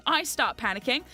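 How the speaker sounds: ordinary talk, nothing marked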